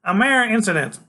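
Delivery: put-on high, loud, lofty kid genius; Freak's voice. A narrator's reading. Freak's boastful character voice.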